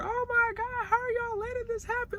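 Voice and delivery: very high squeaky voice, playing the panicked friend; high mock panic. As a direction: squeal it like a frantic girl. Mimicking Jayla panicking.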